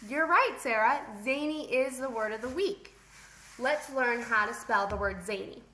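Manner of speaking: usual speaking voice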